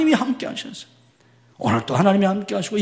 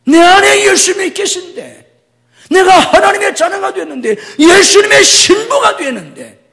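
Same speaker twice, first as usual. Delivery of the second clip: higher, louder proclamation; triumphant shout; 12 semitones higher, 11 dB louder than usual. Acted. Pastor proclaiming his identity in Christ with a joyful shout. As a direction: proclaim it with rising joy.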